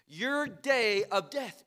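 ordinary delivery

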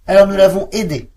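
high, loud barked reproach; barking dogs. Acted. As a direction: bark it back at the witch. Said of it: "aboyèrent les chiens".

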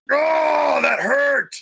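hammed-up bear growl in pain. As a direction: roar it like a wounded bear. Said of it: "She growls and snarls".

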